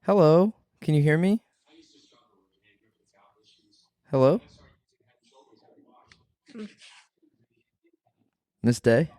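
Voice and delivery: patient call-center hello; customer service voice. Pretend. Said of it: "higher pitched voice".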